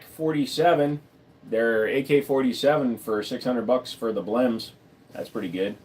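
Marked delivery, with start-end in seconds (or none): none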